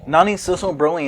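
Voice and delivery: ordinary talk, nothing marked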